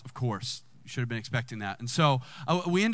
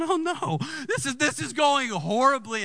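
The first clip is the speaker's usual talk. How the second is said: high-pitched comic dismay; retold panicky "oh no"; mock panic; 10 semitones higher, 3 dB louder than usual. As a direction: play up the dread in a higher voice. Recounts his dismay at hurricane.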